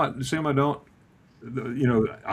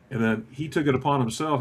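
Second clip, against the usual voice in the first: ordinary talk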